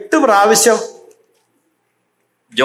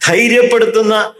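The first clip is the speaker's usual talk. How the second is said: ordinary talk, nothing marked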